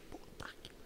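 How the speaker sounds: barely voiced, whispered curse; censored swear. Director quoted in a podcast, self-censored whispered swear. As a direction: hold the swear back under your breath. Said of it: "Não, pode falar".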